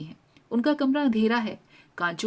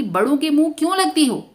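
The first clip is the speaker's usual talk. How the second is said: higher, louder fatherly rebuke; father scolding; 8 semitones higher, 9 dB louder than usual. A narrator's reading. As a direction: scold the cheeky girl sharply. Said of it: "बाबू पता नहीं क्यों धमका देते हैं".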